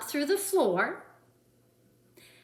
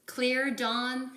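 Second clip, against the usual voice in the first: usual speaking voice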